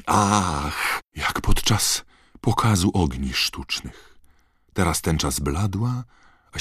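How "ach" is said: drawn-out "ach" of wonder; stifled gasp of awe. A narrator's reading. Crowd watching the fireworks-like show, stifled cry. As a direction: sigh out a muffled cry of amazement. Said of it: "wyrwały się zduszone okrzyki".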